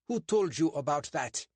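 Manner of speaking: a suspicious bobby lecturing two suspects; portly constable, stern and clipped; English constable. Acted. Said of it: "the constable demanded".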